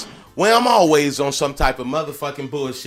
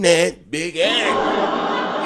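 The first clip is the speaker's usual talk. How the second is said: drawn-out ad name; much slower than usual. Acted.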